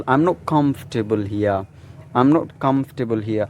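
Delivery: slow "comfortable here" as KUMF-tuh-bul HEE-uh; "comfortable" in three syllables, "here" ending in uh; British-style pronunciation. Shown as a demonstration. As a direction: drop the R in "here". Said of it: "follow the British accent".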